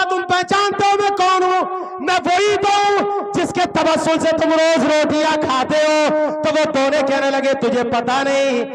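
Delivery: fiery preaching. Acted. Story climax preached at full force.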